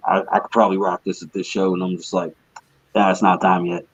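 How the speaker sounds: usual speaking voice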